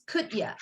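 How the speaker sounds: "could ya" with "you" cut to "ya"; reduced. Shown as a demonstration. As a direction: run "could ya" together.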